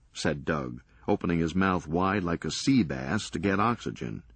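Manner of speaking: plain reading voice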